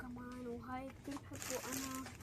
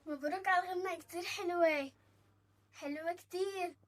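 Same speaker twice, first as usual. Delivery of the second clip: loud, higher, eager voice; excited phone congrats; 5 semitones higher, 8 dB louder than usual. Acted. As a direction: gush down the phone. Child congratulates singer by phone.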